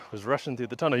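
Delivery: ordinary talk, nothing marked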